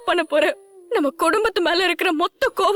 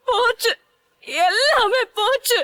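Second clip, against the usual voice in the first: broken-hearted sobbing lament; sobbing despair; 4 semitones higher than usual. Acted. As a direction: cry out that all is lost. Despairing over sister's ruined life.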